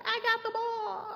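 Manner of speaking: put-on high, proud little kid voice. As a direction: chirp it like a pleased child. Happy kid voiced in story.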